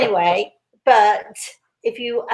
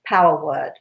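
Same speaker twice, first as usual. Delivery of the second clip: ordinary talk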